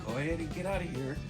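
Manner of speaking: usual speaking voice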